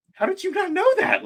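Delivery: very high, squeaky mock disbelief; incredulous thought. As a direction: shoot way up high, acting out baffled disbelief. Acting out own incredulous thought.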